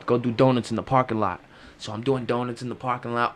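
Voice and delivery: usual speaking voice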